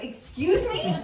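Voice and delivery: high, loud incredulous "excuse me"; can't believe what she just heard; shocked disbelief. Retold or imitated. Stunned at the girl's name.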